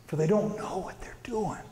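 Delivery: soft, whispery prayer; hushed dying plea. Retold or imitated. Dying Jesus asks the Father to forgive, hushed plea. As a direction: let the voice sink into a near whisper.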